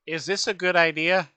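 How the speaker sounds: loud, high nervous protest from the crew; worried crew. Retold or imitated. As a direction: raise your voice and pitch, questioning the captain. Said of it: "the crew being like".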